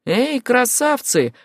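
high, drawn-out taunting call; pirate's shout. A narrator's reading. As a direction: holler at them high and slow. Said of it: "крикнул другой пират". (протяжный крик пирата)

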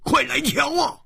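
high-pitched, startled call; cry of surprise. A narrator's reading. Hu Tiehua finding something unexpected, high startled cry. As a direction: yelp and call them over, way up high. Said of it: "胡铁花一声惊呼说".

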